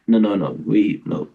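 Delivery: put-on French accent. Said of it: "weird French accent there".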